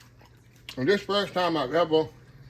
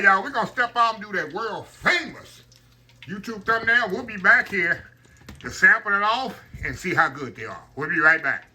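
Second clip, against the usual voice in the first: ordinary talk, nothing marked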